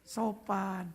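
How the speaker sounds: drawn out slowly, softening at the end; slow, polite. Acted. Comic describing how Javanese talk, slow and polite. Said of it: "kalau ngomong lambat, sopan".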